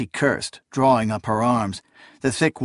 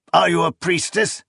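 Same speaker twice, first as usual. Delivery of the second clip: higher barked question; old priest barking; 6 semitones higher than usual. A narrator's reading. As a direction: bark it like a stern elder. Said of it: "he barked".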